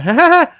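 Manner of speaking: high, loud mock cackle; demon child laugh. Dad imitating Anna laughing at him, demonic cackle. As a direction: imitate a devilish little kid's laugh. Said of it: "she was a demon when she laughed".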